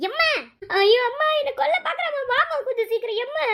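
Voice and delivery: hammed-up wail for mum, pitched high; mock cry of pain to get the sister blamed; fake wailing. Little sister fakes a cry to fool mum, hammed-up wail. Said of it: "என்ன டீ நடிக்கிறியா?".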